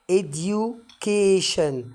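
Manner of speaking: education stretched out syllable by syllable; education, each syllable spoken slowly and clearly. Shown as a demonstration. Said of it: "هاخدها على كذا مقطع". (education ببطء شديد مقطعًا مقطعًا)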